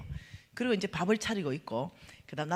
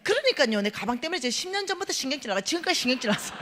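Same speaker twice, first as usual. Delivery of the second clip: higher, louder rushed complaint; fed-up outburst; 5 semitones higher, 4 dB louder, faster than usual. Retold or imitated. Venting to the pastor about the missing bag, exasperated outburst. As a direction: blurt the grievance fast. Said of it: "막 하니까".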